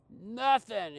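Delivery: put-on high, loud kid yelling back; a defiant kid shouting Nothing; kid screaming. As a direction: yell it like an angry boy. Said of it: "And he's screaming and he's yelling".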